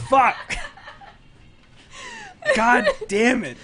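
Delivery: loud laughing curse, high; frustrated outburst. Real, not acted. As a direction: yell it, half laughing. Losing the funny vote.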